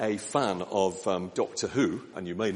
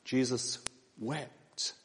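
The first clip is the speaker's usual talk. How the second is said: soft, slow and reverent, almost breathed; hushed and solemn; 8 dB quieter, slower than usual. Acted. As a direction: let the words fall into silence. Solemn repeat of the verse.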